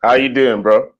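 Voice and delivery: loud greeting over the line. Real, not acted. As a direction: greet him loudly. Guest joins the call loudly.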